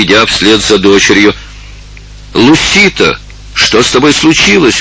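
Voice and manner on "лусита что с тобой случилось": high, urgent cry of concern; alarmed exclamation. A narrator's reading. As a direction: call out to her in alarm, pitch raised. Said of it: "воскликнула Долорес". (встревоженное восклицание)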